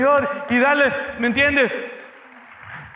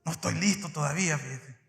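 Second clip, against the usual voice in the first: hesitant excuse; 8 semitones lower, 11 dB quieter than usual. Retold or imitated. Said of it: "Así me dicen".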